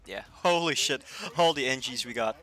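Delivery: loud, amazed exclaiming; excited. Real, not acted. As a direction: shout it in disbelief. Amazed at the enemy team.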